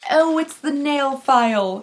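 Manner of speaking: slow, drawn-out mock British accent; bad British accent. Vlogger revealing the next calendar item in a mock British accent. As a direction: put on a hammy British accent, drawling it. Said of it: "said that in a British accent".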